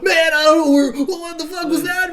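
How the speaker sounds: high-pitched, loud acted panic; panicking Hudson. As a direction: yell it like a soldier losing it, hammed up. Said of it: "oh, he's going".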